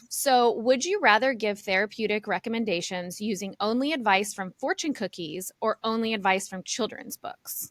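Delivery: ordinary delivery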